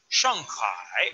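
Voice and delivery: Shanghai with a low dipping hai; third tone on hai. Shown as a demonstration. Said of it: "Acento tercero".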